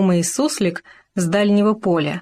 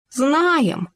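puffed-up; 8 semitones higher than usual. Acted. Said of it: "напыжился суслик".

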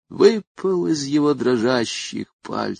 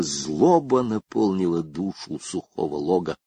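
plain reading voice

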